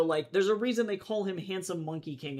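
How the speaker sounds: ordinary talk, nothing marked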